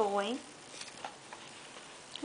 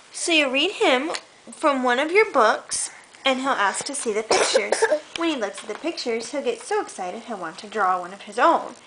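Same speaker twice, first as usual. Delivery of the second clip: put-on reading to a child; 5 semitones lower, 4 dB louder than usual. Reads picture book aloud.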